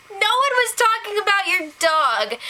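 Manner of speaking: high-pitched incredulous protest; exasperated. Real, not acted. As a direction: protest at an absurd misunderstanding, pitch way up. Exasperated at the absurd misunderstanding.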